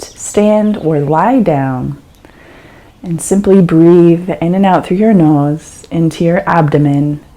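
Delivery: calm, unhurried meditation guide with long pauses; guided meditation. Acted. Teacher guiding a listener through an eyes-open meditation, calm and unhurried.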